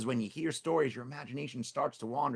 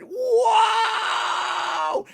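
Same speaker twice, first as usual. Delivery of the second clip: huge high, loud drawn-out whoa; tiger's startled yell; 12 dB louder than usual. Acted. Tiger poked by the spur, long high startled yell. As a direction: howl as the spur jabs him.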